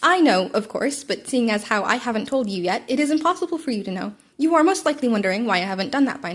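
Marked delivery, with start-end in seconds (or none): none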